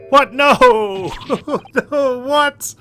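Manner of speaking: loud, high laughing outburst; real, incredulous cry of protest; shocked disbelief. Real, not acted. Player reacting to a game twist, loud shocked disbelief.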